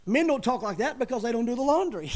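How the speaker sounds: real laugh through a punchline; amused laugh at his own joke. Real, not acted. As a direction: chuckle the joke out. Joke about laundry, laughing.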